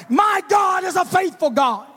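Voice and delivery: high, slow shouted refrain; preached shout. Shown as a demonstration. Preacher crying out the refrain to the church, slow and shouted. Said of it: "because God is a faithful God".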